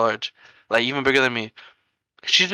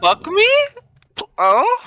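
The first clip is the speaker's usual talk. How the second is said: high, loud, cracking with laughter; laughing mock taunt; 5 dB louder, slower than usual. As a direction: squeal it through a laugh.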